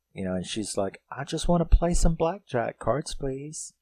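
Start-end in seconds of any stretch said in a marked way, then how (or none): 1.1-3.7 higher, put-on innocent request; voicing the woman. She tells the boss she just wants blackjack, higher mock-innocent voice. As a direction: imitate her asking sweetly, pitched up.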